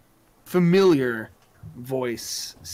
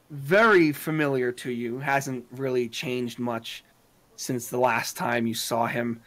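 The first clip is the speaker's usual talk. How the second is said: ordinary talk, nothing marked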